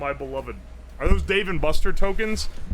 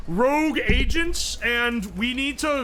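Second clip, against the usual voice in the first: put-on a lightly done Indian accent, higher pitched scam caller; 5 semitones higher than usual. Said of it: "thickest Indian accent in the world".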